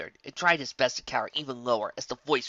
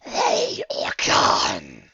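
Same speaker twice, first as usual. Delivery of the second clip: slow, rasping unvoiced whisper of a shadow; eerie rasping shadow voice; dark entity voice; 7 semitones higher, 8 dB louder, much slower than usual. A narrator's reading. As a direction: breathe it out like a looming darkness. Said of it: "it said".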